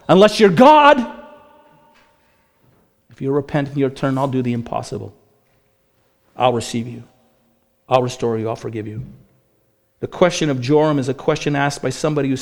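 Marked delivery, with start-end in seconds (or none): 3.1-9.0 tender divine promise. Acted. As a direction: speak as God gently promising mercy. Preacher voices God's mercy again.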